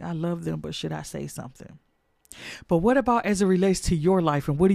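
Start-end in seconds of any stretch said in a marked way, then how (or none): 0.0-1.8 much softer, private musing; inner hesitation. Retold or imitated. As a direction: mutter the doubt to yourself. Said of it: "you're like".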